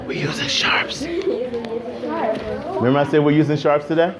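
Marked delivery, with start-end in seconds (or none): 0.1-3.0 a soft whisper, almost no voice; whispered reminder. Real, not acted. Teacher quietly reminds a student.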